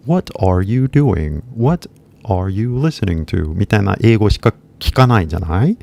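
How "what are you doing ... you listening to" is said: "What are you doing" said slowly with every word clear; slow, over-careful classroom English; slow textbook English. Shown as a demonstration. Teacher mimics the slow English of conversation schools. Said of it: "英会話学校行くと".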